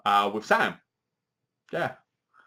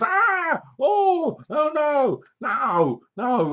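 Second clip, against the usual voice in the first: much higher, hammed-up terrified shrieks; mock screaming; 13 semitones higher, much slower than usual. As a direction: play the horror-movie heroine panicking. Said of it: "she goes".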